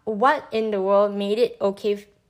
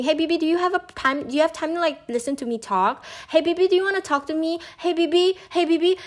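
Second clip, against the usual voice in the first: high, pestering sing-song imitation of a clingy texter; higher and higher mock pestering; mock needy nagging; 6 semitones higher, faster than usual. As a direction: whine each "Hey, B B" higher and faster, needling. Mocking the nagging stalker's messages.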